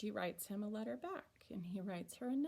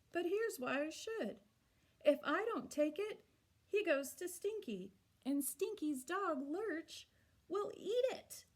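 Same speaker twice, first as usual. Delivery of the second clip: higher, animated read-aloud voice; story reading to children; 4 semitones higher than usual. Acted. Teacher reading a child's letter aloud to her class, animated. Reads the picture book aloud.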